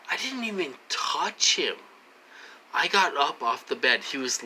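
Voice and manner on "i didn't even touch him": whisper. Real, not acted. Insisting he did nothing.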